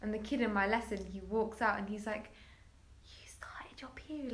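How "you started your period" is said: a hushed whisper, slowed, with no voice; retold whisper. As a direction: breathe it out as a secret question. Boy asking her at doorway.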